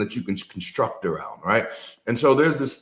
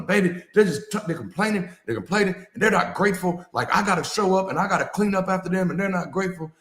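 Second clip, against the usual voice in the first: husband venting to wife; 7 semitones higher than usual. Retold or imitated. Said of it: "he's going off to his wife".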